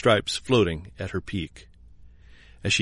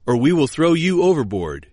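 higher, raised threat; loud threat; 4 semitones higher than usual. A narrator's reading. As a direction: shout the threat across the deck. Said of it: "was the loud cry".

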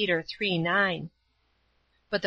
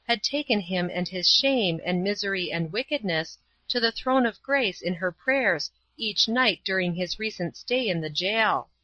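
plain reading voice

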